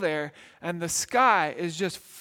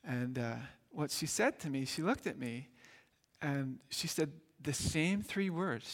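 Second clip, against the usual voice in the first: ordinary talk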